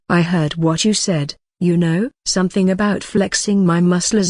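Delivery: ordinary delivery, nothing marked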